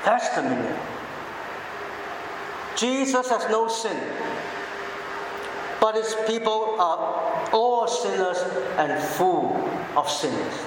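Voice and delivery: ordinary talk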